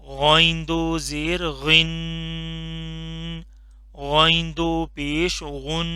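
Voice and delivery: very slow, drawn-out drill for repeating; slow drilled recitation. Shown as a demonstration. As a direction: stretch each syllable for the class to echo. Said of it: "इसको भी मोटा करके पढ़ना है". (बहुत धीमे, खींचकर पढ़ाना)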